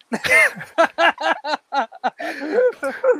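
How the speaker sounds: loud, high burst of laughter; long helpless laugh, high and loud; big laugh. Real, not acted. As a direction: crack up laughing. Admits they are not back.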